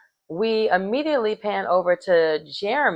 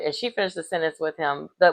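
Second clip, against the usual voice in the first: normal talking voice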